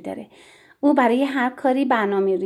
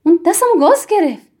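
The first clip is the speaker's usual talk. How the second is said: higher, louder complaint; indignant outcry; 6 semitones higher, 6 dB louder than usual. A narrator's reading. Tiri complains the child bit her, indignant outcry. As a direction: cry out indignantly.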